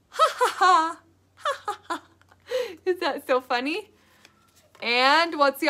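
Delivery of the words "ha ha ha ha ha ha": hammed-up ha ha ha laugh; clown laugh. Shown as a demonstration. Teacher showing kids how a clown laughs, hammed-up laughing. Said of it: "Can you guys laugh?".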